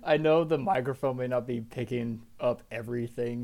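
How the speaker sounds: ordinary delivery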